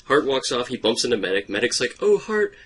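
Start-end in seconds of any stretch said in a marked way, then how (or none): 2.0-2.5 high, pleading apology; pleading girl voice. Retold or imitated. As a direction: beg him to forgive you, voice high. Voicing Medic pleading.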